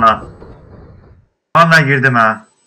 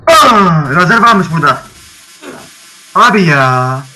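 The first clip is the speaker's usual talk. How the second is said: surprised; 4 semitones higher, 8 dB louder, slower than usual. Real, not acted. Sudden laser trap discovered.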